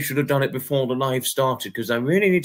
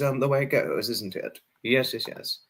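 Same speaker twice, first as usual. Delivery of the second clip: usual speaking voice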